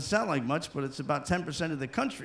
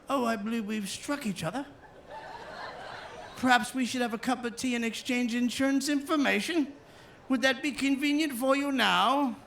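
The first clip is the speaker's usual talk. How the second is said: posh English gentleman; 6 semitones higher than usual. Retold or imitated. Polite elderly English gentlemen.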